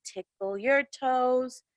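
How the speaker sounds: normal talking voice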